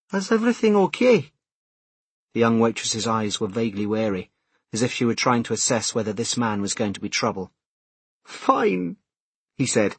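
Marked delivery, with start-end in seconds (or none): 0.1-1.3 put-on higher, wary young woman; young waitress voice. Young waitress asks warily. 8.2-9.0 cracked, strained "fine"; voice cracking. Acted. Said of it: "his voice cracked on the word".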